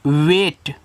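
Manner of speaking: वेट said as "vayt", long ay; correct "ay" reading. Shown as a demonstration. Teacher showing learners how to read wait, वेट as "vayt". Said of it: "इसको पढ़ते हैं".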